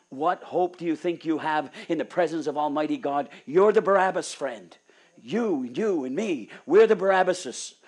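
loud, driving sermon voice; fiery preaching. Acted. Preacher thundering at the congregation about sin, loud full-force preaching. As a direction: preach it hard to the crowd.

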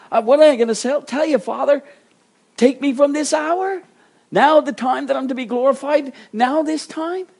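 high, loud acted questions to the Father; dramatized anguished protest; incredulous questioning. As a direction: ask it in disbelief, voice pitched right up. Acts Jesus questioning the Father.

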